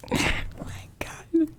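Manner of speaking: ordinary talk, nothing marked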